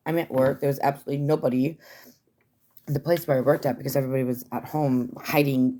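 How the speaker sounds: ordinary talk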